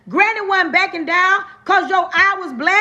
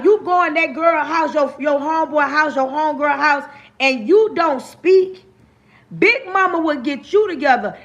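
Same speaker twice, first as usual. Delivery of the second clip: usual speaking voice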